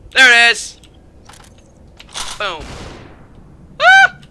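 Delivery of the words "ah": very high, loud short shriek; sudden squeal of alarm; startled yelp. Real, not acted. Gamer caught by surprise mid-game, high startled yelp. Startled while playing a shooter.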